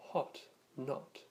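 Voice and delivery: British "hot, not" with a short rounded o; British style accent. Shown as a demonstration. Teacher showing the British spelling with letter O, British short o in "hot, not". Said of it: "more neutral British style accent".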